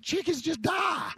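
mock shout. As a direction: exclaim it slowly in disbelief. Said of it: "And I said".

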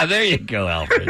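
high laughing. Real, not acted. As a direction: laugh through the words. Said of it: "laughing hard".

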